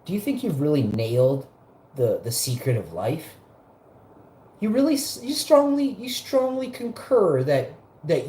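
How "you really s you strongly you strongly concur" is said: mock incredulous. Real, not acted. Mocking rhetorical challenge to viewers.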